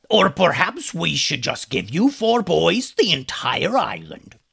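scornful villain pirate; mocking pirate captain. A narrator's reading. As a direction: taunt them with sarcastic generosity, pirate-style. Pirate captain mocks the boys.

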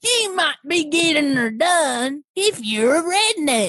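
imitated stand-up catchphrase, drawn out; Jeff Foxworthy. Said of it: "Jaw Foxworthy".

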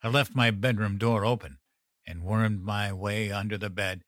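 usual speaking voice

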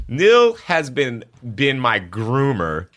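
high and loud, bubbling with laughter; excited teasing. Real, not acted. Telling the crew about her crybaby groomer, high and loud. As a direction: tell it like the best gossip.

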